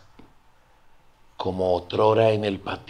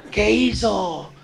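mock whine; 11 semitones higher, 7 dB louder, much slower than usual. Parents demand answer, he cries.

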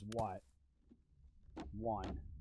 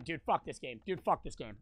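ordinary delivery

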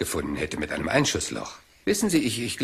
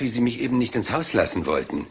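ordinary delivery

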